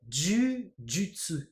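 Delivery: JUJUTSU very slow, long first vowel; Japanese jujutsu. Shown as a demonstration. Teacher reading out the second romaji spelling, JUJUTSU slowly drawn out. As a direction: draw out JUJUTSU in two slow parts. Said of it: "ça s'écrirait comme ça".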